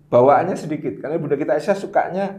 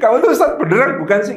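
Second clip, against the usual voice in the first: higher, louder teasing jab; playful mock accusation, raised and loud; mock nagging wife; 6 semitones higher, 8 dB louder than usual. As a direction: needle the ustaz as a nagging wife would. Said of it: "belum pernah dikatain".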